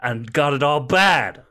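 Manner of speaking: slow, lightly put-on North American accent. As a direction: drawl it like a chatty tourist.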